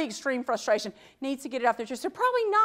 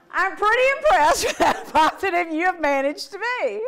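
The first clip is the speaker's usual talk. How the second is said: laughing; 6 semitones higher, 5 dB louder than usual. Real, not acted. Laughing at an over-positive line.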